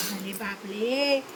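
अरे बापरे lisped as "ale baple", drawn out. Real, not acted. Baby talk to pet, r as l.

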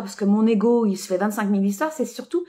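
ordinary talk, nothing marked

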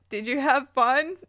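ordinary delivery

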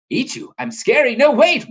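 high, rising storybook protest; dismayed Waffle. Acted.

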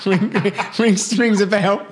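high, laughing, caught out; laughing sheepishly. Real, not acted. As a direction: laugh it off, embarrassed. Caught out by the team name.

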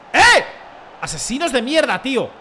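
sharp high yell; real sudden shout. Real, not acted. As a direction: blurt it out at full volume. Outburst during the match.

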